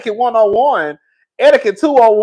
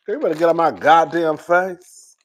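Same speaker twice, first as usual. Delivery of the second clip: dramatic slow warning; 2 semitones lower than usual. Real, not acted. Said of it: "sometimes I'm dramatic".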